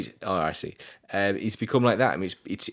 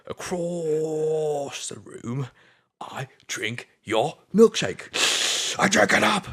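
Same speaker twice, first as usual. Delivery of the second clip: hugely drawn-out, high villain taunt bursting into a shout; Daniel's milkshake voice; 8 semitones higher, much slower than usual. Acted. Acting out Daniel's famous line to Eli, gloating. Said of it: "to do that in that voice".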